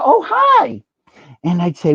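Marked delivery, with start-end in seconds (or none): 0.0-0.8 much higher, airy Oh, hi; mindless star hello. Retold or imitated. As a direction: toss it off like a star who doesn't remember you. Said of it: "she would just go".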